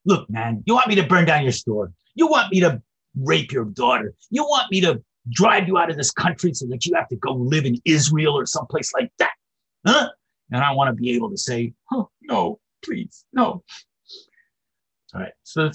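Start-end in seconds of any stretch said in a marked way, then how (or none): 0.0-10.1 louder, threatening neighbor voice; menacing neighbor. Retold or imitated. The neighbor threatens the shopkeeper, louder and menacing. 11.9-13.6 high, pleading no; begging no. Retold or imitated. The shopkeeper begs the angel, high and pleading.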